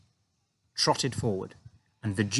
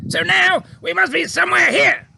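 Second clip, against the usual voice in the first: high, loud blustering officer; outraged officer; 9 dB louder than usual. A narrator's reading. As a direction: Air Force chief shouting at the pilot, outraged. Air Force head voiced.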